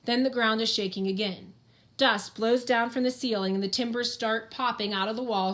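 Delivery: ordinary delivery, nothing marked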